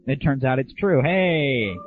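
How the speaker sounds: ordinary talk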